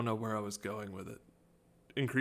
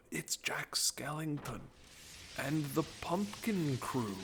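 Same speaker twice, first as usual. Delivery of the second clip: hushed awe; 4 semitones higher, 6 dB quieter, slower than usual. Acted. Dramatic hushed reveal of characters.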